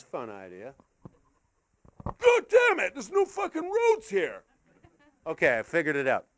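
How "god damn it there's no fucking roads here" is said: very high-pitched, drawn-out mock complaint; frustrated little character. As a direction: grumble in a tiny squeaky voice, slowly. Same little character, finds no roads.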